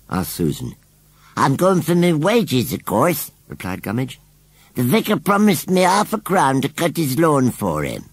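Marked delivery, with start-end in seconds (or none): 1.4-3.3 tag. Acted. Scarecrow answers the children. 4.7-8.0 higher-pitched scarecrow voice; indignant scarecrow. Acted. Scarecrow explains his promised pay to the children, indignant.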